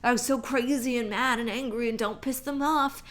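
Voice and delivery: high, loud, exaggerated alarm; mocking what everyone says. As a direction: parody a panicked public warning. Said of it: "everyone's always like".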